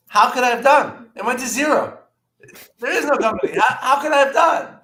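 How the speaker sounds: loud, high-pitched exasperated rant; re-enacted shouting, voice way up; indignant outburst. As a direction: throw the question back in outraged disbelief. Acted outburst at company man.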